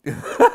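high shrieking laugh; burst of laughter. Real, not acted. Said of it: "Was ist denn das für eine Frau?".

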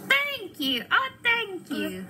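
high, gushing thanks; high-pitched effusive gratitude; grateful witch. A narrator's reading. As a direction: gush with relief as the rescued witch. Witch thanks her rescuers.

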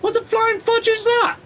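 high, incredulous squeal; baffled. Real, not acted. Seeing an odd new building appear, squealing in disbelief. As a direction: pitch it way up in sheer disbelief.

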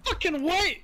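sharp, high defensive snap. Real, not acted. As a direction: bristle back fast. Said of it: "you sounded so defensive so quick".

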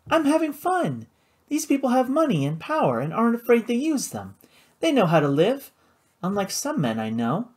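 flaunt your fun, starting high; carefree sister. A narrator's reading. Flora needling her brother about fun, breezily.

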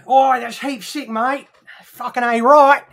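high-pitched, hammed-up Aussie accent; Australian accent. Shown as a demonstration. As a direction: put on a broad Ocker Australian accent. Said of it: "Have you managed to master the Australian accent".

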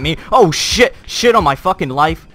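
loud scared outburst; panicked yelling. Real, not acted. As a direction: shout in panic at the threat.

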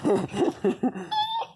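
high burst of laughter; real laugh. Real, not acted. Owner laughing at her pitbull. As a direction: laugh out at the dog.

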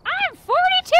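put-on high, loud childish shout of an age; quoting a child. Gamer quoting a meme to friends, high and loud like a child. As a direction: squeal it like a proud little kid. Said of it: "the joke is that, like, a six-year-old says it".

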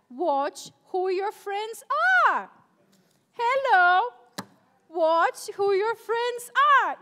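slow, loud, hammered warning; emphatic shout. Real, not acted. Youth pastor shouting a warning to the teens, emphatic. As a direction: drive the warning home to the youth. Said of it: "I cannot emphasize this enough".

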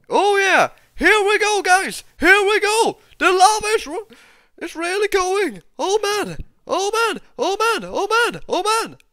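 high, loud thrilled shouts; excited yelling. Real, not acted. Gamer watching lava start flowing, high excited yelling. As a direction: cry out as it all kicks off.

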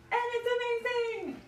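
showing off the heated floors with real excitement; excited. Real, not acted. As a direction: gush about it like it's the best thing ever. Gushing about heated bathroom floors.

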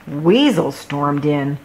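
usual speaking voice

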